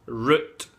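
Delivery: root said with a tight rounded oo, Scottish; Glasgow root. Shown as a demonstration. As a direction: say root like ruut, Scots vowel. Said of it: "Now root is root".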